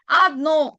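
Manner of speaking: "Adno" with weight on its last o; stress on last syllable. Shown as a demonstration. Teacher showing learners where the accent falls, "Adno" stressed on the final o. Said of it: "en la última o".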